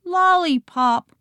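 put-on high little baby voice. A narrator's reading. Baby adding a last word, high baby voice. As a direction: pipe it up like a toddler. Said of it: "Baby Max said".

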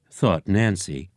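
ordinary delivery, nothing marked

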